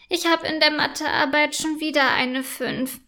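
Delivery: usual speaking voice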